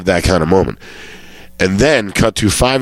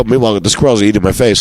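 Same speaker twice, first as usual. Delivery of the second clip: ordinary delivery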